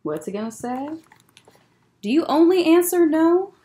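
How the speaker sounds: usual speaking voice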